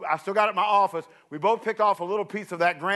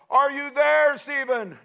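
calling out; 6 semitones higher, slower than usual. Retold or imitated. Calling up the mountain.

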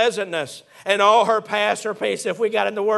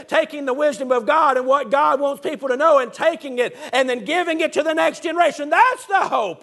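climbing higher toward the word hope; rising preaching; 6 semitones higher than usual. Acted. Preacher telling the church the next generation's hope, rising preaching.

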